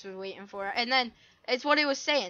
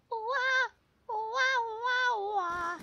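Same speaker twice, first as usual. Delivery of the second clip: silly wails; 13 semitones higher, much slower than usual. Acted.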